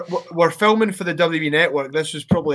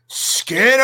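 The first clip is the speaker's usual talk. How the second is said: sudden higher mock yell across the ring; retold shout; 7 semitones higher than usual. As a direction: bark the name out of nowhere, like a Simpsons gag. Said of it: "he just shouts".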